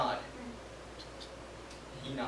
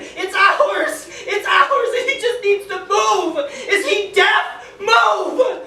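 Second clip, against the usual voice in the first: much higher and louder, frantic; frantic yelling as the enemy closes in; panicked shouting; 13 dB louder, much slower than usual. Retold or imitated. Soldier panicking as gunfire nears, frantic yell.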